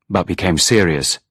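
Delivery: ordinary delivery, nothing marked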